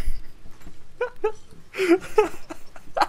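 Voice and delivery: breathy suppressed giggle; stifled laugh. Real, not acted. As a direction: try to hold in a laugh. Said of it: "I can't help it".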